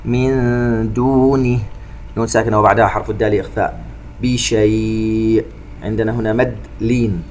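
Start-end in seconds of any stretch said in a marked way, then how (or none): 0.0-1.6 slow chanted tajweed recitation with echoing qāf; Quran recitation. Shown as a demonstration. 4.2-5.4 slow chanted tajweed recitation with echoing qāf; Quran recitation. Shown as a demonstration.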